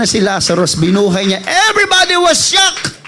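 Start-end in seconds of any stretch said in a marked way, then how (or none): 1.7-3.0 shocked. Retold or imitated. Retelling Lazarus raised, crowd shock.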